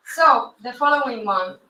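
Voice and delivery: ordinary talk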